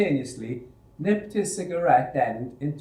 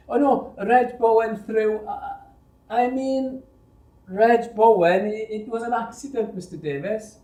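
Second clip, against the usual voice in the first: panicked stammer; 9 semitones higher, 5 dB louder than usual. Acted. Frightened boy blurts then covers.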